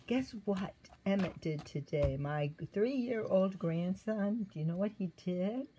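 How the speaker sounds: ordinary delivery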